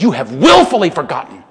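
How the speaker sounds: loud, cutting rebuke; blistering rebuke. Retold or imitated. Peter rebuking the scoffers, a loud blistering charge. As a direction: lash them with the words. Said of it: "He blistered them".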